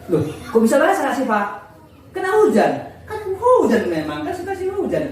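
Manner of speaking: suara tinggi menirukan obrolan; percakapan dengan murid. Retold or imitated. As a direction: dialog guru dan murid, nada tinggi. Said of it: "saya bilang sama anak itu". (guru bertanya pada Asifah, nada tinggi heran)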